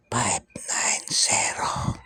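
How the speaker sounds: slow hushed whisper, barely voiced. Shown as a demonstration. Vlogger whispering the lotto tip to viewers, slow and hushed. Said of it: "Bubulong natin sa inyo".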